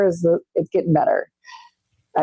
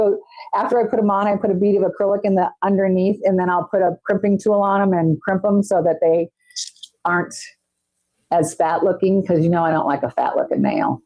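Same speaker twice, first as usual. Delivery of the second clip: ordinary talk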